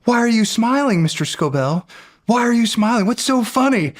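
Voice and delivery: mock scolding. Upperclasswoman scolding the smiling plebe, mock stern. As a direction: interrogate the grinning plebe. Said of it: "she's like".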